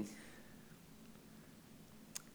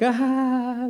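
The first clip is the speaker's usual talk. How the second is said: forced laugh; 11 semitones higher, 11 dB louder than usual. Retold or imitated. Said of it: "like, trying to laugh".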